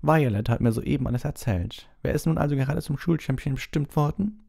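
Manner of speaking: high, sing-song portrait lady voice; fluting high painted lady; Fat Lady. Acted. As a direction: coo it in a lilting high voice. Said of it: "sagte die fette Dame".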